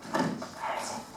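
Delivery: hushed, voiceless search; sneaky whisper in an exam. Acted. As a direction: whisper so the teacher can't hear. Searching for phone in test.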